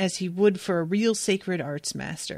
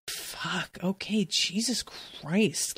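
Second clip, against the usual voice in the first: much softer, slow, defeated mutter; exhausted resignation; 8 dB quieter, much slower than usual. Retold or imitated. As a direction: give in, drained and deflated. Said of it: "And he's just like".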